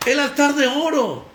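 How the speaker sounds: booming preacher call; repeated loud shout; loud proclamation. Real, not acted. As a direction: proclaim it loudly.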